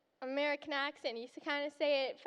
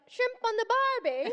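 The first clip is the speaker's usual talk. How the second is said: higher, louder hammed-up Australian catchphrase; mock Aussie accent; 6 semitones higher, 6 dB louder than usual. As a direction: imitate someone blurting an Aussie cliché. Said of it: "immediately someone's like".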